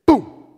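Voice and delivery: sudden loud boom; a high, explosive thud noise breaking the pause; imitated crash. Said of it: "all we hear is".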